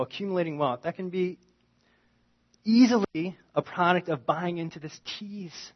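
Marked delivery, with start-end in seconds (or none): none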